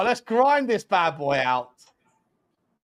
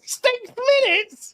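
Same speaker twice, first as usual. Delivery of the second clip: high, slow mocking "Six minutes?"; mocking squeal; 13 semitones higher, slower than usual. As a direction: stretch it out in a high sneer. Mocking the referee's added time.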